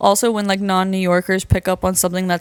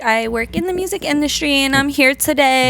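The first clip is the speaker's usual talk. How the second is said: put-on higher-pitched Valley girl introduction; 6 semitones higher than usual. Showing the voice people expect, Valley girl. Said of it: "people think I talk like this".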